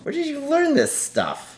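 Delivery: high amazed question. Real, not acted. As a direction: sound impressed and surprised. Amazed at kid's science.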